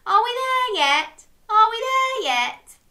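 high, loud singsong chant; impatient child in the car. Acted. Child pestering from the back of the car, high whiny chant. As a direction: pester like a whining kid on a long trip. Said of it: "sit in the car and say".

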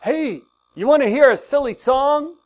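a hint of an eager little boy; grandson's voice. Pastor voicing grandson AJ asking: light childlike eagerness. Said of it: "He would say".

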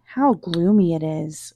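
usual speaking voice

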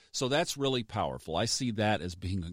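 ordinary delivery

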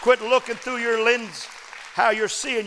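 slow, high, loud building preacher shout; preaching at full force. Acted. Preacher rallying the congregation with full force.

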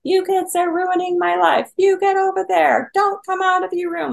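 put-on high, loud, furious yelling at the kids; retold angry scream. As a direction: reenact the mother screaming at her kids, pitch way up. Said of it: "I was screaming at my kids".

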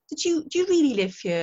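put-on curious kid. Kid asking the costumed dairymaid, curious. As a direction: ask like a child half believing it.

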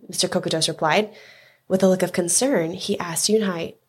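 ordinary delivery, nothing marked

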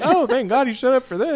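high, loud, laughing mock relief; hammed-up thank God; mock grateful husband joke; sarcastic relief. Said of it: "I'm like".

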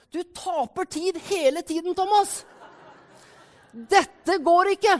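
acted shout building to a drawn-out verdict; shouted discouragement. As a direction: yell it from the sideline, slowing hard at the end. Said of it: "så skriker han".

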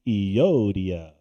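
low, drawn-out Euodia with a hammed-up foreign accent; a mock foreign accent, rehearsing the name at a desk; exaggerated mock accent. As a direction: ham up a Middle Eastern accent on Euodia, the last one loud. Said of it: "He's just looking at a screen going".

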